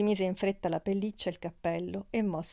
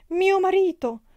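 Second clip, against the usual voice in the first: high, loud cry of alarm; 13 semitones higher, 10 dB louder than usual. A narrator's reading. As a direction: cry out in panic, pitch leaping up. Woman cries out at husband's knock. (grido di spavento, acuto e forte)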